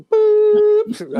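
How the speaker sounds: very high, loud beep imitation; alarm beep. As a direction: blare out a sharp alarm beep "Boop".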